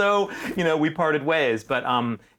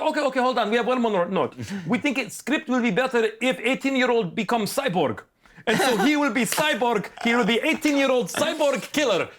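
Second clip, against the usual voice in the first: high, hammed-up German accent, pitching proudly; mock German accent; 9 semitones higher than usual. German director pitching cyborg twist, hammed-up German accent. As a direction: announce the big idea in a thick German accent. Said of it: "directors sound like Hans and Franz".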